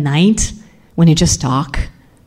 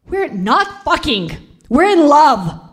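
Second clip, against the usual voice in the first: high, slow, desperate protest; distressed protest; 10 semitones higher, slower than usual. Retold or imitated. Wife defending herself to her raging husband, protesting. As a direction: insist on it, pitched high and slowed down.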